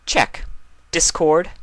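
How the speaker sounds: high, loud royal roll call; Luna's regal call. Acted. Luna calling the first name on her list. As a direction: call the name like a princess taking attendance.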